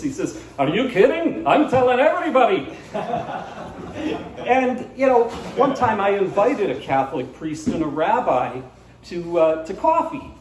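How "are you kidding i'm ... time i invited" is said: put-on high, loud, gleeful Borscht Belt old man; Borscht Belt accent. Old man boasting to the priest, high loud Borscht Belt accent. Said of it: "Borscht Belt accent".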